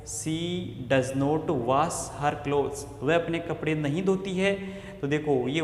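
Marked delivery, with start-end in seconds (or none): 0.1-2.8 slow dictation. Shown as a demonstration. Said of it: "यहाँ देखो".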